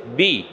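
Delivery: বি with a short i. Shown as a demonstration. Teacher contrasting the plain short বি for students. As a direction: keep the i in বি short and light. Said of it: "উচ্চারণ কি হবে?".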